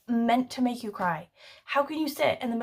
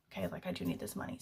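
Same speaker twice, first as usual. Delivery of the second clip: whisper; 14 semitones lower, 12 dB quieter, faster than usual. Retold or imitated. Maite agreeing to the job.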